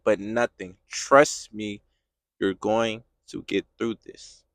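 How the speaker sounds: low, quiet, unbudging answer; firm reassurance. Acted. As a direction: hush the protest softly and surely. God firmly, quietly ends the protest.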